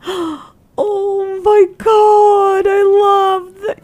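very high, slow, loud mock-crying; overwhelmed fan wail. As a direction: wail it out in hammed-up, drugged adoration. Acting out drugged, overwhelmed reaction.

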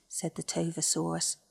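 plain reading voice

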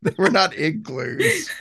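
laughing through the words, slow; giggling, can't keep a straight face. Real, not acted. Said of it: "you said igloos".

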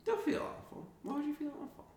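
soft, voiceless whisper; hushed mock comforting; whispered consoling. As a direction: whisper gentle reassurance, half in play. Mock-consoles host who felt awful.

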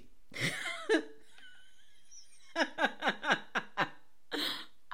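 helpless laugh, starting nearly silent; real drawn-out cackle of amusement; real laughing fit. Real, not acted. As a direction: crack up, wheezing into a run of chuckles. Cracks up at her own quote.

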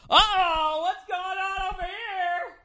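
high, slow sing-song sportscaster call; hammed-up announcer voice cutting in; loud announcer. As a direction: announce it to the whole bar like a game caller. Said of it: "The fucking announcer".

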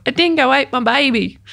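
a half-hearted attempt at Australian accent; Lindy Chamberlain. Quoting the infamous line, weak Australian accent. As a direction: tries the famous Australian accent line and falls short. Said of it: "I can't do her accent".

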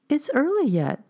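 high-pitched gentle plea; pleading. A narrator's reading. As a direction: plead with her to stay, pitch lifted high. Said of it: "pleaded the stranger".

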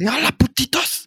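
high-pitched mock Mexican accent; high, put-on Mexican greeting; Kick-Ass Mexican. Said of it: "Un mexicano ahí como el de Kick-Ass".

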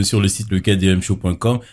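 ordinary talk

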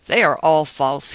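loud, forceful bitter outburst; energetic exclamation. A narrator's reading. Bitter man declares to his friend, loud and forceful. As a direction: throw it out with full force. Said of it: "he exclaimed with energy".

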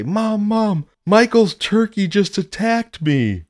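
put-on high, pleading child; little kid voice. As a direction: tattle to mom like a small kid. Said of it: "and I say".

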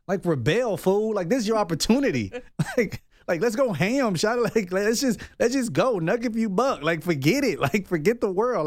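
high, laughing hype to go wild; reckless inner voice. Retold or imitated. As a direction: egg yourself on, laughing, pitch way up. Voicing the reckless inner urge.

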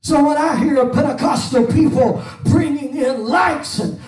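high, loud fiery preacher's cry; preaching at full force. Acted. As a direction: preach it out hard over the music. Preaching over music.

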